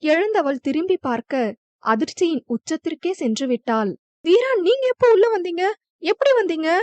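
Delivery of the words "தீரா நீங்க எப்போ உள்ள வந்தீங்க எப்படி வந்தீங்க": higher, quick startled questions; flustered surprise. A narrator's reading. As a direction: blurt it out, startled and agitated. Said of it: "பதற்றமாகவும் ஆச்சரியமாகவும் கேட்க". (பதற்றமும் ஆச்சரியமும் கலந்து)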